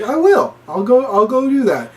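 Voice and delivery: high, eager volunteering. Retold or imitated. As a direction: jump in eagerly. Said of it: "Jay's like".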